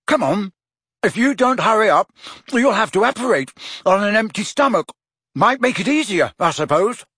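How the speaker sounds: a hint of a cheeky teenage boy; lightly voiced Ron. A narrator's reading. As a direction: nudge your friend along cheekily. Ron teasing Harry.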